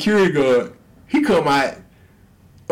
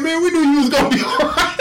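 laughing excuse; 11 semitones higher, 3 dB louder than usual. Retold or imitated. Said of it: "It's like".

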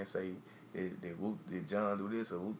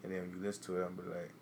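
usual speaking voice

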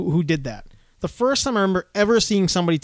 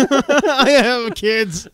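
mock pleading; 5 semitones higher, 4 dB louder, much slower than usual. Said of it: "he begged me".

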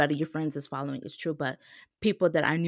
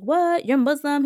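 surprised; 9 semitones higher, 9 dB louder than usual. Retold or imitated. Uncle hears the news.

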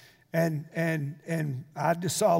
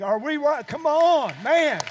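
rallying shout; 7 semitones higher, 7 dB louder, slower than usual. Real, not acted. Preacher rallies congregation.

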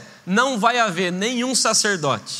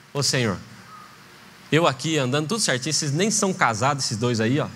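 grumbling prayer; 7 semitones lower than usual. Acted. Jealous grumbling prayer to God.

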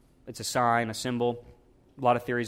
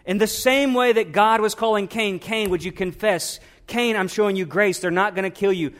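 louder, higher preacher's climb, drawing out key words; full-force preaching; 5 semitones higher, 4 dB louder than usual. Acted. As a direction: preach it out to the congregation, building.

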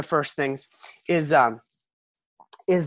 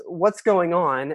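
what's going on; much slower than usual. Retold or imitated. Jesus arrives at argument.

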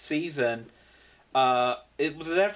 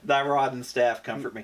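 mock preaching; 4 semitones lower than usual. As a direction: wail it like a revival preacher. Mock scripture about joke.

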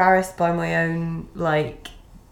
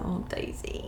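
breathy whispered aside; whispering; 12 dB quieter, slower than usual. Real, not acted. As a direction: whisper it under your breath.

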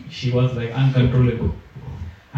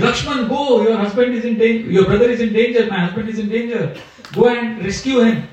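higher, urgent, panicky plea; frightened pleading; 6 semitones higher than usual. Acted. Sita, frightened, urging Lakshman to save Ram, high and urgent. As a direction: beg him to go, voice pitched up in fear. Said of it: "she became anxious and she became bewildered by fear".

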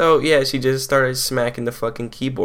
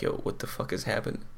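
quick whispered what-the-hell; whispered disbelief; 9 dB quieter, faster than usual. Retold or imitated. Students staring at each other, fast whispered confusion. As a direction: hush it fast to your classmates. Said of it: "staring at each other like".

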